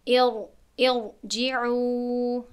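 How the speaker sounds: ارجعوا chanted slowly, ر heavy; recitation with heavy r. Shown as a demonstration. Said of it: "را باید با تفخیم".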